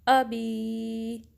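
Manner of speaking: अबी with a crisp hamza on अ, said very slowly; sharp hamza, drawn out. Shown as a demonstration. Teacher recites अबी from Surah Masad for students. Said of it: "आ को sharply पढ़ना है".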